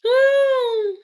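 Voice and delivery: high-pitched cackling laugh; burst of laughter. Real, not acted. Cracking up at her own story, high loud laugh.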